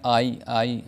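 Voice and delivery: आई pulled long, said twice; stretched ai. Shown as a demonstration. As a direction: draw out आई. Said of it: "आई थोड़ा खींचकर पढ़ा जा रहा है". (आई खींचकर)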